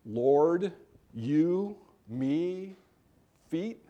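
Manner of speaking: choppy, rising indignant Peter; indignant stutter. Shown as a demonstration. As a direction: sputter each word in outraged disbelief. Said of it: "he's so indignant about this that he's stuttering".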